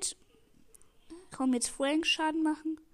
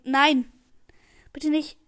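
loud sudden cry of alarm; panicked shout; 9 dB louder, slower than usual. Real, not acted. Gamer watching the enemy attack, loud panicked cry. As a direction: yell it as the goal threatens.

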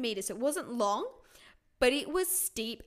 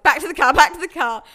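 loud, frantic retold demand; urgent shout; 3 semitones higher, 14 dB louder than usual. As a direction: blurt it out loudly, desperate to leave. Retold desperate rush for toilet.

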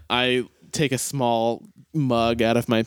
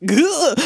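sudden high startled yelp; 12 semitones higher, 4 dB louder than usual. Acted. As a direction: yelp in surprise. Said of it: "He's like".

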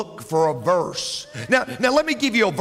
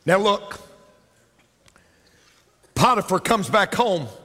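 ordinary delivery

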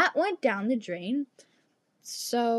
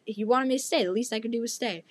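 normal talking voice

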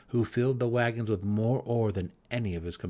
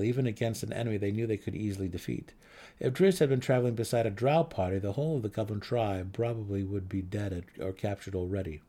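ordinary delivery

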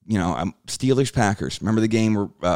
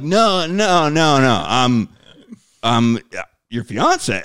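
high, loud, flustered "no, no" pushed up in pitch; panicked denial; 5 semitones higher, 6 dB louder, much slower than usual. Retold or imitated. Denying to the angry fiance on the phone, flustered and loud. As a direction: stammer out a frantic denial.

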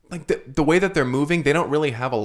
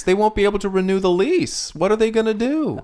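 mock worry; 6 semitones higher than usual. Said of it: "I'm a bit worried".

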